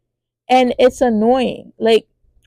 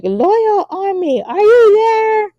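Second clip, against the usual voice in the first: high, slow, commanding call; royal summons; 12 semitones higher, slower than usual. Retold or imitated. King calling on his loyal army, high slow call. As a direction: call your soldiers out with calm authority. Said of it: "he was like".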